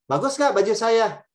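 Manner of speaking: fishing for a compliment on the outfit; eager question. Acted. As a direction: ask hopefully about your outfit. Asks thinking person about clothes.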